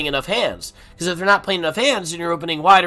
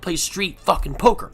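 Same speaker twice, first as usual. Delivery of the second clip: ordinary delivery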